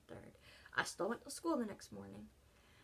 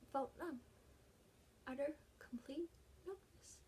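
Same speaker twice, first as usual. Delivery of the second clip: hushed whisper; 4 semitones higher, 9 dB quieter than usual. Real, not acted. Describing grief.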